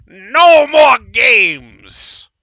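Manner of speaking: full-throated furious bellow, drawn out slowly; old wizard screaming at the card table; wizard's shout. Acted. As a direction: roar it like a wizard losing his temper. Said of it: "And he shouts".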